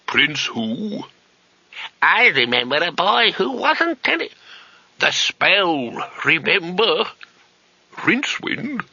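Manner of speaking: put-on very high, slow incredulous old man; old wizard voice. A narrator's reading. Old wizard doubting the name through the door, high and slow. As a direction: stretch the name out in aged disbelief.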